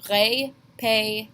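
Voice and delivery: Répétez, three clear ay sounds, slow; slow French demo. Shown as a demonstration. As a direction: say it slowly again. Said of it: "two E accent aigus".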